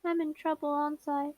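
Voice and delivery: little sister expecting a scolding; sheepish little sister. Acted. Little sister character fears a scolding.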